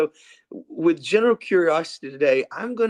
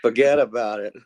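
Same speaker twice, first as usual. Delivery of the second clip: New York "forget about it"; tough-guy New York accent; 4 semitones lower, 4 dB louder than usual. Retold or imitated. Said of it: "my New York accent".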